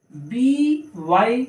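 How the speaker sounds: बी, वाई as two slow letters; spelling out. Shown as a demonstration. Teacher spelling an example word, slow letters. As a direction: stretch each letter name.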